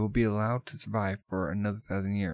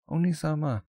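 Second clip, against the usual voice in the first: alarmed cry; 7 semitones higher than usual. Acted. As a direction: call out in alarm. Sister shocked at the taunt.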